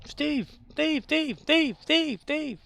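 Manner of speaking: high, loud cartoonish call; high meerkat yell for Alan. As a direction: shout the name like a frantic meerkat. Imitating meerkat calling out Steve.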